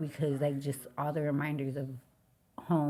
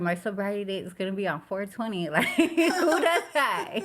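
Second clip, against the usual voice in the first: higher, louder, breaking into laughter; real amused disbelief; 6 semitones higher, 6 dB louder than usual. Real, not acted. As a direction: laugh at your own absurd luck. Amused retelling of absurd sobriety date.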